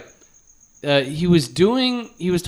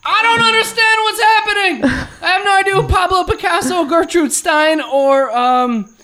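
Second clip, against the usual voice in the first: put-on very high childish voice fading back down; mock whiny kid; 12 semitones higher than usual. Host mocks the teen reviewer in a very high kid voice. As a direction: squeak it out like a clueless kid, then sink. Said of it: "He's like".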